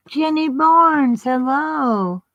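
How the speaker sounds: high, slow sing-song hello; excited greeting. Real, not acted. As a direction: call out her name brightly. Greeting friend on live stream.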